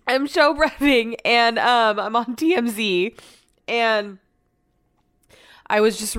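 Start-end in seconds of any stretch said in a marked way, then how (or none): none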